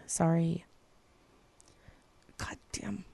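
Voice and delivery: ordinary talk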